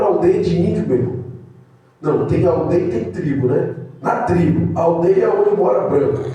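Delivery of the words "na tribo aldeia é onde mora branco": ordinary talk, nothing marked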